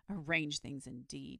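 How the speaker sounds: a touch of resentful scorn; bitter muttering. A narrator's reading. Boy alone after the dragon's refusal, bitterly. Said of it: "he said bitterly to himself".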